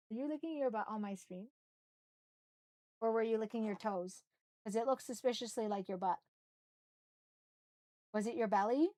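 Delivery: hushed, teasing voice for the dog; soft pet talk. Real, not acted. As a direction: murmur softly to the pet. Streamer talks to her dog.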